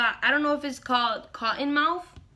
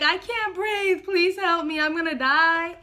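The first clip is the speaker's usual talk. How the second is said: mock panic; 5 semitones higher than usual. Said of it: "I'm like".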